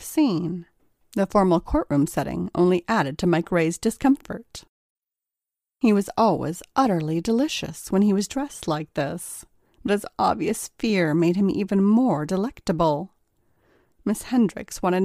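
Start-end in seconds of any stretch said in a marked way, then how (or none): none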